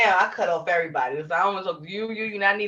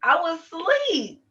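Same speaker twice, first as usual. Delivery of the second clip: innocent lie; 9 semitones higher, much slower than usual. Retold or imitated. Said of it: "I'm like".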